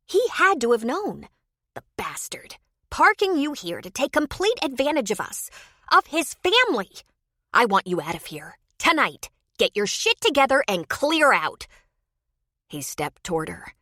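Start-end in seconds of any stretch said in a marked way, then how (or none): 0.1-11.7 angry outburst. Acted. Wife furious at conman.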